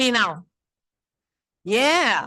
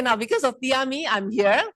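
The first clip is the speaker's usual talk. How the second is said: ordinary talk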